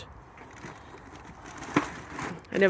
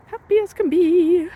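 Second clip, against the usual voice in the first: chirpy sing-song; 11 semitones higher, 7 dB louder, slower than usual. Acted. Cheery chirp about the boat.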